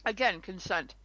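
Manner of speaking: talking while eating; mouth full. Real, not acted. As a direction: say it round a bite of food. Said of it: "I'm sorry, I'm eating".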